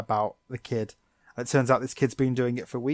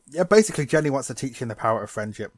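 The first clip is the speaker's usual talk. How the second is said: normal talking voice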